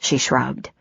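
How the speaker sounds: plain reading voice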